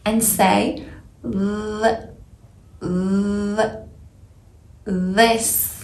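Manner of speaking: "th" buzzed with voice, tongue between teeth; "this" slow with a voiced th buzz. Shown as a demonstration. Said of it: "and use your voice".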